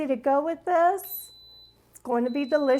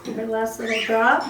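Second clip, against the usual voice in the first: usual speaking voice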